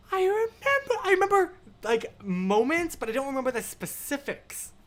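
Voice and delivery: high squealed rush of recognition; nostalgic excitement. Acted. Reader revisiting childhood books, nostalgic and excited. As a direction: squeal as memories come flooding back. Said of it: "it's so nostalgic to be like".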